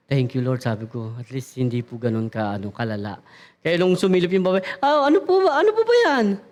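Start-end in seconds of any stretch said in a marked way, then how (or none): 0.1-3.2 low, soft grateful murmur; low, hushed thanks to the Lord; relieved murmur. Retold or imitated. Relieved quiet prayer. 4.8-6.4 high, sharp annoyed questioning; mock irritated woman after a scrape. Woman confronting after collision.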